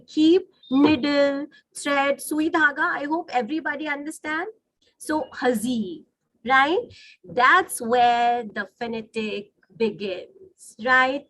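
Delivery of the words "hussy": HAZI slowly as huz-ee; correct housewife. Shown as a demonstration. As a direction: model "hazi" as one contracted word. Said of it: "but it's hazi".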